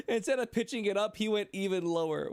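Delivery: high-pitched mock voice. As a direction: squeak it out teasingly.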